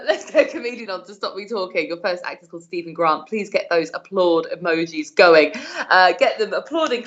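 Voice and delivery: ordinary talk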